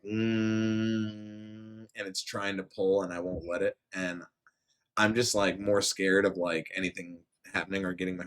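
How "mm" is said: machine hum. Retold or imitated. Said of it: "you hear the machine literally just like".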